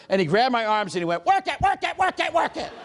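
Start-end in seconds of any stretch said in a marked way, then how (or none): 1.3-2.7 high, rhythmic urging; high-pitched peppy command; mock fitness instructor. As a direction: chant it like an excitable aerobics coach. Imitates the instructor urging him on.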